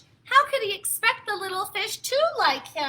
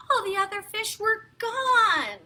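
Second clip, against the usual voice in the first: plain reading voice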